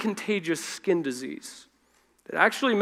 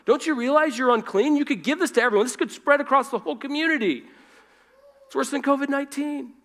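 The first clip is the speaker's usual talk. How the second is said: high, louder scolding rebuke; mock reprimand; 8 semitones higher, 3 dB louder, faster than usual. Preacher imagining Jesus rebuking the leper, mock angry and loud. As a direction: pretend to scold and shove him away. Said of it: "his response could have been".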